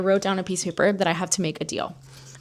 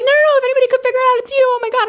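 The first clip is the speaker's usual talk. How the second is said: very high, racing, louder friend voice; frantic reassurance; 6 dB louder, much faster than usual. Retold or imitated. Friend reassuring her during a FaceTime meltdown, frantic high mimicry. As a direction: rush it out in a high-pitched fluster.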